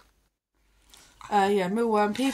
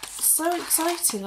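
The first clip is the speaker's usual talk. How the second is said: high dog-talk; 8 semitones higher, slower than usual. Real, not acted.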